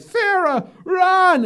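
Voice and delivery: high, loud urgent shout; Kyle yelling at Sarah. Retold or imitated. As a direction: scream a warning at her to flee. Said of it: "He's like".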